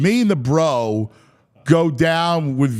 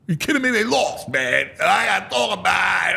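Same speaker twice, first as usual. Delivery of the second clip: barking at him; 3 semitones higher than usual. Retold or imitated. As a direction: bark it out, fed up after the loss. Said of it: "I start barking".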